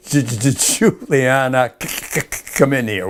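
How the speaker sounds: stuttering boss, tripping on each first sound; angry stutter. Retold or imitated. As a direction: mimic the sergeant's angry stammer. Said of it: "he would stutter badly".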